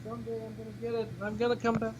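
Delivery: shouted from another room; calling from far off. Real, not acted. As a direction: call back from a distance. Guest calling from outside room.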